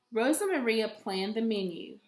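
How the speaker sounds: plain reading voice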